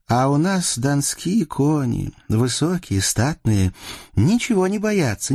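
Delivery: put-on high-pitched girlish boasting; spirited girl listing merits with pride; proud Cossack girl. A narrator's reading. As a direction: show off your people's horses. Ksenia praises Don horses.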